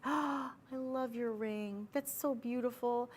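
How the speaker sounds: soft, high, slow gushing compliment; soft breathy admiration, slow and high; admiring gush. Retold or imitated. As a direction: coo over a friend's ring. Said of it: "and you say it in that voice".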